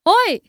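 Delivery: sudden high-pitched yelp of pain; cry of pain. A narrator's reading. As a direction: cry out as if just kicked. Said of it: "વેદનાથી ઊંડી ચીસ પાડી". (વેદનાની ચીસ)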